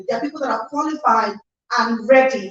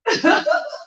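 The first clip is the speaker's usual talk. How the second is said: high giggle; 11 semitones higher than usual. Real, not acted. Laugh after singing.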